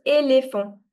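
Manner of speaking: éléphant with A in both accented syllables; E changed to A. Shown as a demonstration. Teacher recapping the accent for students, E as A in éléphant. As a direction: model éléphant with clear A vowels. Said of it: "will change from E to A".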